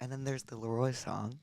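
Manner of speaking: very softly dropped-in mention. Real, not acted. Host gently raising another song with the singer, softly. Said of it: "That was so soft".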